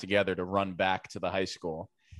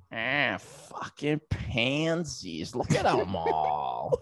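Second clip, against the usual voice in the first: lightly voiced tough old coach, drawn out; a hint of a scornful farmer dad; gruff coach; 4 semitones higher, 4 dB louder, slower than usual. Chris Prescott scorning the boys in the barn, lightly gruff. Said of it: "he goes".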